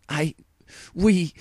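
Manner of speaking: sputtering fury; seething. A narrator's reading. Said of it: "Luna seethed".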